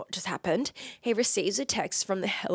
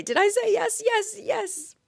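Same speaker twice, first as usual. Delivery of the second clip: high, squealed joyful reenactment; giddy high-pitched delight; excited acceptance; 14 semitones higher than usual. Retold or imitated.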